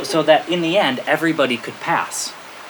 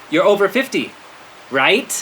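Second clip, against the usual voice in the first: higher, insistent leading question; pointed hinting; 6 semitones higher than usual. Retold or imitated. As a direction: press the hint, lifting "right". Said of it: "he has to be tough".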